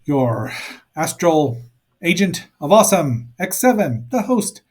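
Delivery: big booming host intro; announcer voice. Acted. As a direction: announce it like a superhero show host. Host's hammed-up podcast intro.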